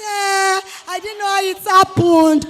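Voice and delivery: loud, high, whining pretend excuse; loud mock-innocent wail; mock shocked girl. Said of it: "Before you say".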